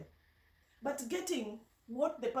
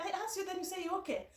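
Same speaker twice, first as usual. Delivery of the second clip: exasperated boss; 8 semitones higher, faster than usual. Retold or imitated. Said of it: "So she was like".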